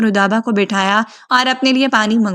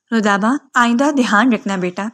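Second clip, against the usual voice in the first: ordinary delivery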